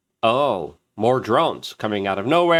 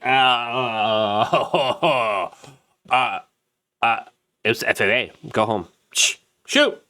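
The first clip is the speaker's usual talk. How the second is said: mock press secretary. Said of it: "Carrie Ann Levitt came out and she's like".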